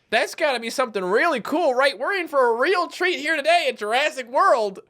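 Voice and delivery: high, singsong mock anticipation; clueless onlookers. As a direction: play an eager, gullible park guest. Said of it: "Look at these idiots standing up here".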